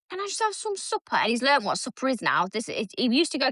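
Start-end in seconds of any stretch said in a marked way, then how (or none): 0.1-1.2 put-on higher kid voice, pleading; imitating her son. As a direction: beg sweetly like a hungry boy. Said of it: "Every night he's like".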